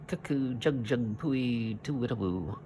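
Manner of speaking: chirpy imitated bird calls; mock birdsong. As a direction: whistle the words like spring birds. Imitating birdsong in Haddock's speech.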